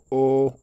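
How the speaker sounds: O held high, loud and level; long, high first-tone O. Shown as a demonstration. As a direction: sing out O on one high steady pitch. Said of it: "On a le premier ton".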